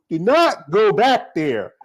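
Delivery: normal talking voice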